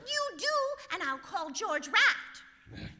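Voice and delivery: loud, slow comeback; loud retort. Acted. Wife fires back at the store manager, loud and slow. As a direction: top his threat, slowly and loud.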